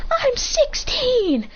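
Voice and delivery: put-on high, slow, childish protest; little kid voice. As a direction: squeak it out like an upset kid. Crying kid answers in story.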